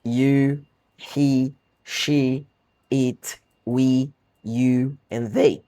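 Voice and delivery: pronouns said slowly and carefully, "you" drawn out long; pronunciation demo. Shown as a demonstration. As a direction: model each pronoun clearly for learners. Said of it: "Os únicos detalhezinhos que facilitam".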